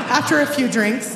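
ordinary talk

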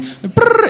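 trilled "brrr" phone ring. Retold or imitated. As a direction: imitate a phone ringing with your lips. Imitates a phone ringing.

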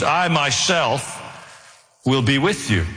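"I myself" said slowly and deliberately, then "will be with you"; slow, weighted "I myself"; stress on I myself. Shown as a demonstration. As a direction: lean slowly on "I myself" before finishing the line. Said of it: "it's an emphatic way of making a point".

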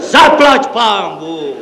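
long, high exhalation of relief; director's heartfelt, drawn-out thanks; relieved. A narrator's reading. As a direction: let it out slowly, high then falling. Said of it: "odechl si ředitel".

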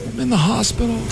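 mock hospital patient. Prank call line retold.

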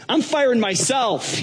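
high, drawn-out announcement; hammed-up exasperated resignation; indignant quitting. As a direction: declare it grandly, stretching the words. Acted Donald quitting in protest.

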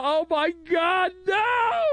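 high shrieking fake panic; very high mock scream; mock hysterical shriek. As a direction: scream like a horrified pearl-clutcher. Mocking hysterical outrage.

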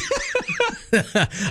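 shrill burst of laughter; high squealing laugh. Real, not acted. Host laughs at own joke.

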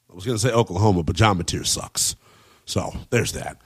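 low, dry, trailing-off dig; a muttered low deadpan jab. Acted.

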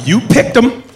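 high-pitched, mock accusing question; accusing look voiced. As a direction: put the blame on them, pointedly high.

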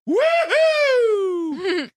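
high whooping woohoo; loud upstairs neighbor woohoo; retold woohoo yell. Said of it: "the guy would always yell".

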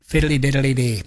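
put-on playful stab at an Irish accent; mock Irish accent. Radio guest dared to try an accent, mock Irish lilt. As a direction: have a cheeky crack at sounding Irish. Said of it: "I would like to do an accent".

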